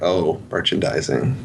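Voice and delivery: ordinary talk, nothing marked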